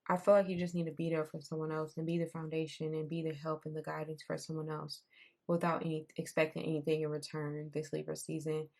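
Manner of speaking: ordinary talk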